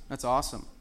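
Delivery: ordinary talk, nothing marked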